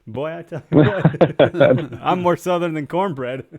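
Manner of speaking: slow, hammed-up Southern accent laughing through it; southern drawl. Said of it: "be like".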